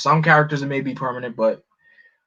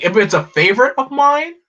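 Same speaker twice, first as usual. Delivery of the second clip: ordinary delivery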